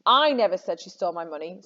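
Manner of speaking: "I" stressed, the rest following lightly. Shown as a demonstration. Teacher shows students how meaning shifts, stressing "I". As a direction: lean on the opening "I". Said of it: "someone else said it".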